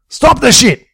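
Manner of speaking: high, loud yelled command; yelling. A narrator's reading. Jimbo orders brawling men to stop, yelling. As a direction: yell it to halt a fight. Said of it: "Jimbo yelled at him".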